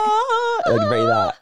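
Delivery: high Mexican grito; grito yell. Shown as a demonstration. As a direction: let out a high festive grito. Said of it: "it's like, the scream".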